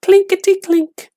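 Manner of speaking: very high, tinny clinking voice; coins rattling. A narrator's reading. Coins rattling in the gravedigger's tin box, high clinking voice. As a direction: ring it out like tiny silver coins. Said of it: "the money went clinkety-clink".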